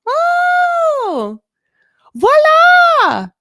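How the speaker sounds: high, loud whooping cheer. Acted. Parent cheering a child's first steps, high shouted cheer. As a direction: cheer it out, stretching the last word. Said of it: "people cheering".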